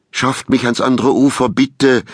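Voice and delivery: high, urgent begging; pleading. A narrator's reading. Fugitive begging the fisherman to take him across, pleading. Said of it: "flehte Baumgarten".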